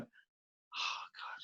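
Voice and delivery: barely voiced, breath-only sigh to himself; whispered dread. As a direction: whisper it as a dismayed inner thought. Dreading the favour, inner voice.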